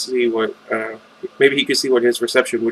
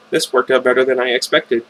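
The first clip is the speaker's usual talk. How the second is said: ordinary delivery, nothing marked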